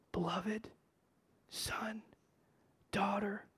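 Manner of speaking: breathy whisper, barely there; tender whisper. Acted. God whispering lovingly to his son and daughter. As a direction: whisper it like God calling his own child.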